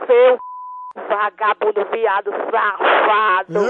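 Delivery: angry. Real, not acted. Insulting prank caller.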